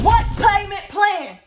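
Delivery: high, drawn-out yell; loud exasperated scream; outraged shout. Retold or imitated. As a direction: holler it slowly in total disbelief. Acted outburst at payment plan.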